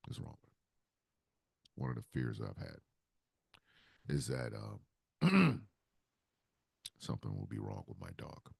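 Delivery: fighting back tears over his sick dog; worried, near tears. Real, not acted. Worried about his old dog.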